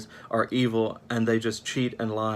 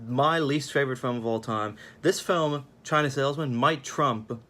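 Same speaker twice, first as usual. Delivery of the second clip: ordinary talk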